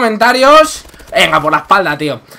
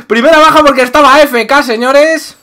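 laughing; 5 semitones higher, 4 dB louder than usual. Real, not acted. Laughing at easy kill.

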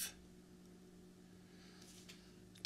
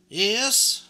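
loud, high eager agreement; jolly shopkeeper; 9 semitones higher, 9 dB louder than usual. A narrator's reading. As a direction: agree warmly and loudly. Doll mender agrees with customer.

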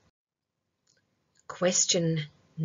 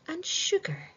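playful whisper; 9 semitones higher, 5 dB quieter than usual. Acted. Quizmaster whispering to the children, hushed. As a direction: drop to a secret whisper. Quiz host teasing the options.

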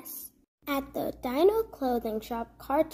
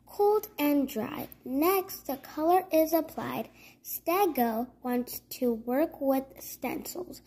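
plain reading voice

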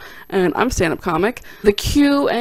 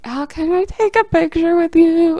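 1 timid high plea; 10 semitones higher, 4 dB louder than usual. Retold or imitated. Third try, terrified fan.

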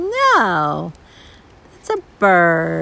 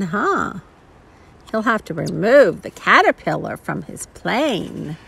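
usual speaking voice

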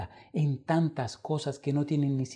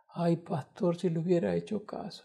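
weak whisper; 5 semitones higher, 7 dB quieter than usual. Retold or imitated. Dying man regrets, hospital bed.

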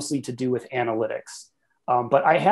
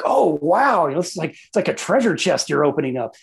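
giddy; 2 semitones higher, 4 dB louder than usual. Acted. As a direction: gasp of delight like a customer opening a treasure. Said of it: "you're kinda giddy".

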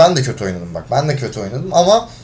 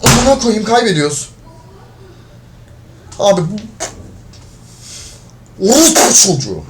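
angry outburst; 7 semitones higher than usual. Real, not acted. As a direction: blow up at the useless teammates. Losing match, raging at teammates.

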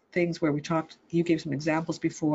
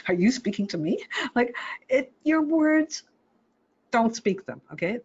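mock toddler; 8 semitones higher than usual. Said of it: "it's like".

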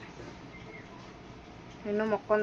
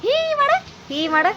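much higher, louder coaxing sing-song; 8 dB louder than usual. Real, not acted. Mother coaxing her baby son to play, in high baby talk.

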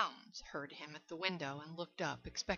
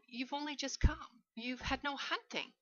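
higher, mock-hurt protest; 8 semitones higher than usual. A narrator's reading. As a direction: object as if wounded by the idea. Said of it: "the general protested".

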